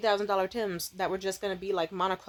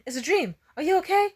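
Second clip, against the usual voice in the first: put-on worried boyfriend; 8 semitones higher, 4 dB louder, faster than usual. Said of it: "He was like".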